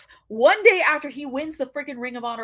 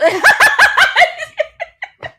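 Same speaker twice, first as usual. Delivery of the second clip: high squealing burst of laughter; shrieking laugh; 12 dB louder than usual. Real, not acted. Cracking up at the coffee comment, a shrieking laugh. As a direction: lose it in a loud high laugh.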